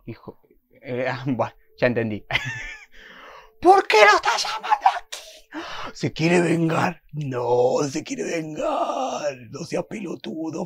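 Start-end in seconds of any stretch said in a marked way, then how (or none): 2.3-3.6 very high, airy laugh; incredulous laugh. Real, not acted. Viewer disbelieving the call being made, high laugh. 3.6-5.9 very high, loud, drawn-out scream; shrieking disbelief. Real, not acted. Viewer horrified at the phone call, high shriek. 5.9-10.7 very slow, drawn-out lament; dismayed wail. Real, not acted. Viewer dreading the character's revenge, drawn-out dismayed wail.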